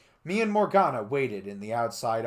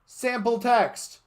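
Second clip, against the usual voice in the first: high, slow deadpan; deadpan robot; 13 semitones higher, 9 dB louder, slower than usual. Acted. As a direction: say it like placeholder text. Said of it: "she said".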